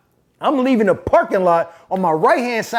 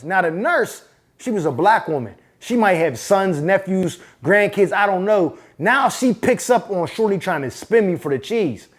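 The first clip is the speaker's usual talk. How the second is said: ordinary delivery, nothing marked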